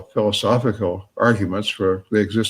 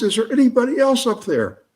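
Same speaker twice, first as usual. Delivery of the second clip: high, strained yell upward; top of his voice; 12 semitones higher than usual. Acted. Man in the well, third desperate yell. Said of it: "at the top of his voice".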